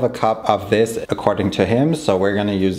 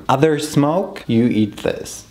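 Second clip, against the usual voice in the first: ordinary talk, nothing marked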